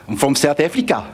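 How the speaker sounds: exaggerated South African coach's accent. Coach tells boy his country, thick South African accent. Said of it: "He's like".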